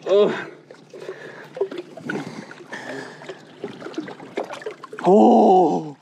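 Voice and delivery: strained outburst. Real, not acted. Struggling to grab fish.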